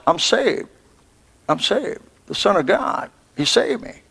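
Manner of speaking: hushed thinking aloud; the thief's inner voice, very soft; quiet self-reassurance. Acted. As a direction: murmur it softly to yourself. Thief reassuring himself in thought.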